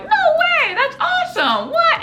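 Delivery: very high squeal of disbelief; excited brother. Retold or imitated. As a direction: squeal like a thrilled sibling. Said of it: "And even he's excited".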